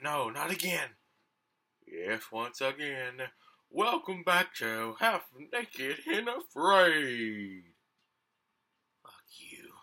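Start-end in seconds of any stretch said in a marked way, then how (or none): 3.7-7.6 announcer voice. Acted. Game host introduces show. 9.0-9.7 breathy whispered curse; whispering. Real, not acted. Muttered insult.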